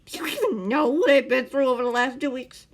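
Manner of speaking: loud furious rant. A narrator's reading. The man ranting at Scar about his spy mission, loud. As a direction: pour out the grievance, building. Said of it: "the man ranted".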